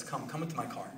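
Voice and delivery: very soft, low retold invitation; quietly coax, voicing a kindly rebbe; gentle invitation. Rebbe invites a shamed stranger into his car, gently.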